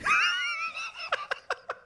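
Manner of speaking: big shrieking laugh. Real, not acted. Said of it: "reminded me of something that we would say in, like, fourth grade".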